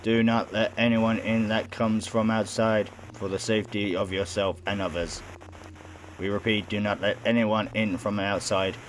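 monotone public service warning; robot reading out an announcement; robotic announcer. Acted. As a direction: deliver it evenly like an automated broadcast. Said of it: "The voice is robotic".

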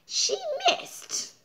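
tiny squeaky voice; high squeaky miss. Acted. Swatter misses fly.

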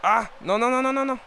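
high, loud string of no; panicked shout. Real, not acted. Gamer panicking as the opponent shoots, loud high no. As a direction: yell in rising alarm. Danger in front of goal.

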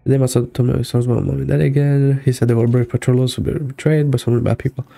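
ordinary talk

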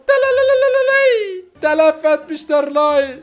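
theatrical keening. Acted. Follower mourns the mighty commando brother, theatrical keening. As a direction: wail out a hammed-up lament. Keening over the dead leader.

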